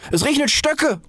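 high-pitched startled goose; alarmed cry. A narrator's reading. Goose seeing sticks rain down, high squawk. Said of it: "schrie die Gans auf".

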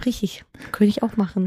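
teasing whisper. Real, not acted. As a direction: whisper it slyly. Teasing reply in banter.

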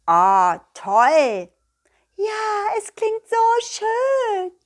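high, slow, dreamy little voice; admiring nettle. Acted. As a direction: swoon over the lovely sound. (hohe, langsame, schwärmerische Stimme)

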